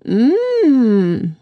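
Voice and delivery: goûte et fait hum; hum gourmand. A narrator's reading. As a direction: hum appréciatif de vieille dame. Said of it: "dit-elle". (la vieille dame goûte la tarte, hum gourmand)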